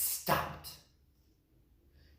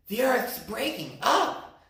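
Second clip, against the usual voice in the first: panting; 9 semitones higher, 3 dB louder than usual. Acted. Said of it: "they panted".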